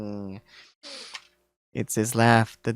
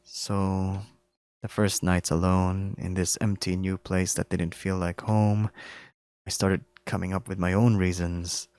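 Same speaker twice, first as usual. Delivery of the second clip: softer, even, drained of feeling; monotone, emotionless; 7 semitones lower, 7 dB quieter than usual. Acted. Bear confessing his loneliness to the deer, monotone and emotionless. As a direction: recite it as if nothing matters now. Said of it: "His voice has become monotone and emotionless".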